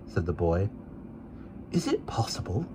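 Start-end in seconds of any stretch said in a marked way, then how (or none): 1.7-2.7 soft unvoiced whisper. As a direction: breathe it so the child can't hear. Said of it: "whispered my guardian".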